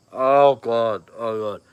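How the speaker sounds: sudden loud gasp of mock dread. Dad recalling the scary side of parenting, loud mock dread. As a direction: blurt it out like a parent's panic.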